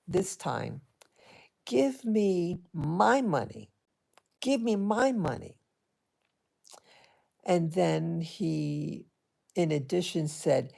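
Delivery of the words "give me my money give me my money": slow, deliberate, insistent demand; emphatic demand. Retold or imitated. Eldest boy demanding money from the narrator, slow and emphatic. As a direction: lean on each word like a demand. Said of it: "he said very emphatic".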